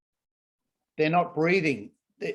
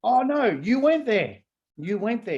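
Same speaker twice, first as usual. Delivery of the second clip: much higher and louder, thrilled; excited exclamation; 7 semitones higher, 4 dB louder than usual. Retold or imitated. Students emerging from meditation, thrilled, high and loud. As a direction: gush about it like a discovery. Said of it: "They go".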